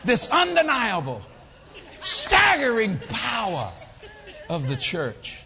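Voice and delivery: loud, slow, drawn-out preacher's proclamation; big, slow pulpit delivery; emphatic preaching. Real, not acted. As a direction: stretch each word and raise it to the room. Preaches at full force.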